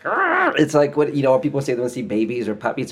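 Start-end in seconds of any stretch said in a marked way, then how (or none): 0.0-0.6 high strangled squeal at something cute; squeal of cuteness. Retold or imitated. To his girlfriend, a high cute-overload squeal. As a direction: squeal like you could squish it.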